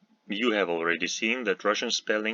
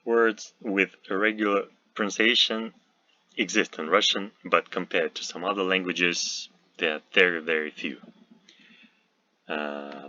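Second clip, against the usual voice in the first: ordinary talk, nothing marked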